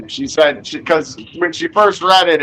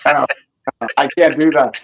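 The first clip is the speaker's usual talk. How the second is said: normal talking voice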